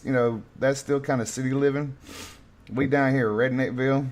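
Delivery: normal talking voice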